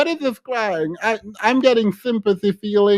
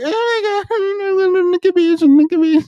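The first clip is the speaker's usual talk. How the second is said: higher pleading whine; mock begging whine; 8 semitones higher, 4 dB louder than usual. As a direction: plead like a needy man. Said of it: "Just begging".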